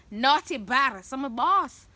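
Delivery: ordinary delivery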